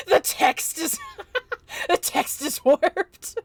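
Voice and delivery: high giggling through the words; laughing while talking. Real, not acted. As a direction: laugh as you read it. Laughing at warped text.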